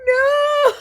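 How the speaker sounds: high, loud wailed denial; hammed-up sobbing squeal, close to laughter; mock crying. Crying guest answers her alarmed friend, comic high wail. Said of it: "I'm crying".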